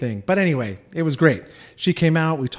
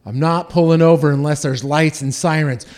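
stubborn loud insistence; 8 dB louder than usual. Retold or imitated. Stubborn teen refusing friends.

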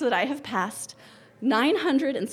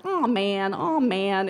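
imitating toddlers; much slower than usual. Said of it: "they'll say it, like, four times".

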